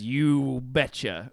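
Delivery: slow Midwestern you betcha; drawn-out Wisconsin catchphrase; imitating a Midwestern farmer; mock Midwestern accent. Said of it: "he says".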